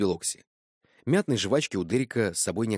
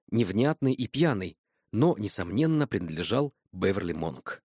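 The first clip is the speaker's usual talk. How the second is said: ordinary delivery, nothing marked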